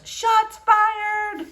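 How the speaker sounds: high, hooting crowd cry; excited taunt. Acted. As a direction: whoop it at the loser.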